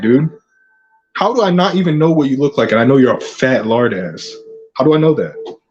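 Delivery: normal talking voice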